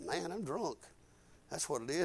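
soft, low murmur to himself; dazed self-talk. Retold or imitated. Preacher recalling his woozy thought, hushed self-talk. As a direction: say it low, as a private realization.

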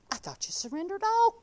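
ordinary delivery

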